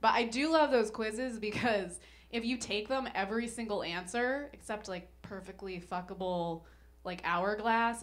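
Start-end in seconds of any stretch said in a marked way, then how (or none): none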